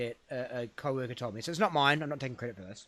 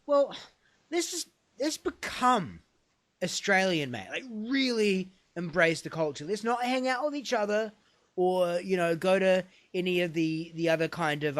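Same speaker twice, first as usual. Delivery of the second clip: higher, eager pitch to a mate; acted enthusiasm; 5 semitones higher, 4 dB louder than usual. Immigrant urging his friend to go Australian, excited. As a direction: sell the big plan, drawing out the key words. Joke character pitches a bold plan.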